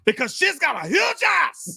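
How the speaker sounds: high, slow, shouted movie outburst; Pacino. Acted. Co-host quoting favorite Heat line, shouted Pacino impression. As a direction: explode into a hammed-up actor's yell. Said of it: "I can only do Pacino".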